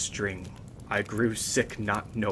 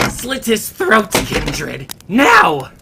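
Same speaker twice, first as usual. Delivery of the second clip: shrill, much higher frantic command, very loud; screaming lute player; 12 semitones higher, 14 dB louder, much slower than usual. Acted. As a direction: scream the order at Kindred in a put-on voice. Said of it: "stopped and shouted".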